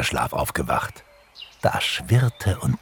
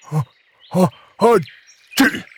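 sneeze; 14 semitones higher, 5 dB louder, faster than usual. A narrator's reading. Bear sniffs the flowers and sneezes. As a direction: build up in gasps, then sneeze. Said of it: "nieste er".

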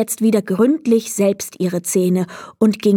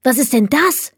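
put-on excited girl; 4 semitones higher than usual. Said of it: "rief sie aufgeregt".

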